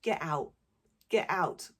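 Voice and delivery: flippant. Retold or imitated. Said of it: "I said to him quite flippantly".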